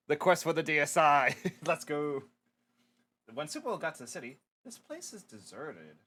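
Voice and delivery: normal talking voice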